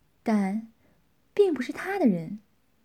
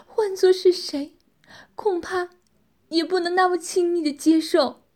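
higher-pitched, aggrieved woman's plea; tearful pleading; 5 semitones higher, 3 dB louder than usual. A narrator's reading. Tianqi confiding family loss to Tianxiang, tearful. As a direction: plead as if close to tears, pitched up. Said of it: "泪眼汪汪地说道".

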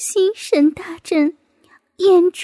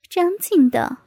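usual speaking voice

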